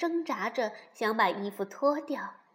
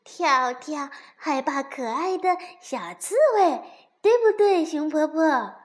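high, loud excited accusation; gleeful tattling; 3 semitones higher, 8 dB louder than usual. A narrator's reading. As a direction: turn the tables delightedly. Friend tells on the teaser. (得意地揭短)

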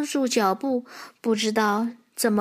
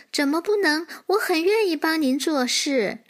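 higher-pitched, bouncy eager foal; eager little horse; 7 semitones higher than usual. Acted. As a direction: answer eagerly, as if skipping about. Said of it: "小马连蹦带跳地说". (小马蹦蹦跳跳、兴冲冲地说)

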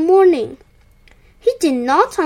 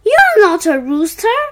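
loud, higher-pitched scolding; calling out; 4 semitones higher, 7 dB louder than usual. A narrator's reading. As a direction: call it across as a reprimand. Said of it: "he called".

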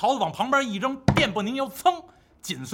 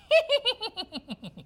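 a high, gleeful mocking cackle; triumphant trickster laugh; 13 semitones higher than usual. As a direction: laugh at the fooled pig. Wukong laughs, revealing himself.

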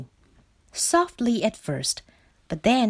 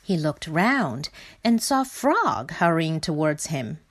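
ordinary delivery

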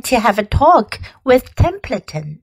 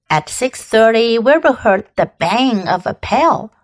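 ordinary delivery

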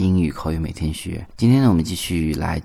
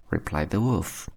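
ordinary delivery

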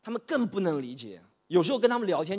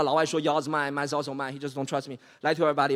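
usual speaking voice

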